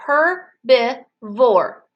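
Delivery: "Herbivore" very slowly in three beats; slow, evenly spaced "Herbivore"; separated syllables. Shown as a demonstration. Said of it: "let's clap that word together".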